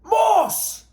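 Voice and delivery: high hollered name. Acted. Bert hollering after the deserting Moss, high yell. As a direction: yell after a fleeing man. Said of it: "Bert hollered after him".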